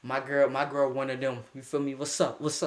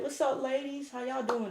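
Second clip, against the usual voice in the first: higher, softer mock-smooth hello; smooth greeting; 9 semitones higher, 5 dB quieter than usual. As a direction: greet the girls like a smooth talker. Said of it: "we like".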